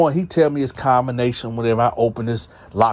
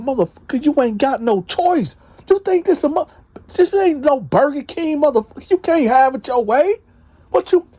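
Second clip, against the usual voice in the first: furious threat; 13 semitones higher than usual. Retold or imitated. Storyteller yelling threats at his cellmate, furious.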